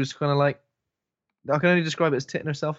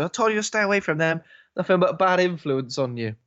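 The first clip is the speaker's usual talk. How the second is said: lightly voiced disapproving husband, pitched up; mild higher-pitched mock reproach; mock scolding; 5 semitones higher than usual. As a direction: tell them off with a touch of comic nagging.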